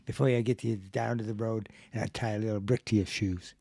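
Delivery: put-on low Boston accent tough talk; low, casual Boston threat. Cohost copying the demonstration, low in a Boston accent. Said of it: "if you wanna do a Boston accent".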